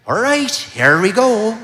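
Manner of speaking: cheesy leprechaun-style Irish lilt, high and loud; silly Irish lilt. Retold or imitated. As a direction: ham up a cartoon Irish lilt, high and slow. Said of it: "this silly little Irish lilt".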